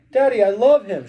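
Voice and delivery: high, loud plea to a father; pleading cry. A narrator's reading. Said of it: "she cried".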